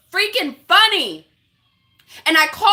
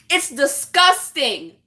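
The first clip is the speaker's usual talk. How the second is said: slow, deliberate, contained condemnation; slow disgust; slower than usual. Real, not acted. Woman condemning jokes about abuse, slow and contained. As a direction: weigh out each word in disgust. Repeats her verdict, first take.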